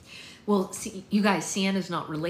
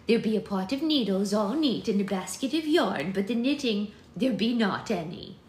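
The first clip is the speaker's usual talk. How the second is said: louder, higher archaic voice; old-fashioned servant voice; 3 semitones higher, 5 dB louder than usual. Acted. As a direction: report it breathlessly in old speech. Prudence's voice.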